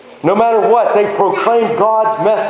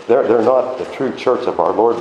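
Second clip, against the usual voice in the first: normal talking voice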